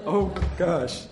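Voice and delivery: usual speaking voice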